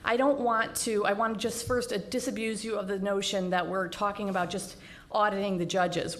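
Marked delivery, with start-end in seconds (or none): none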